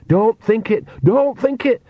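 high-pitched urgent warning. A narrator's reading. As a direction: plead urgently, pitch leaping up. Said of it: "said Nanny urgently".

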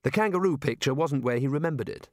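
usual speaking voice

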